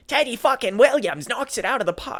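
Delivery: high, mock Boston accent, hammed up; bad Boston accent. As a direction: imitate a terrible fake Boston accent. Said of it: "hearing Eli Roth do that terrible".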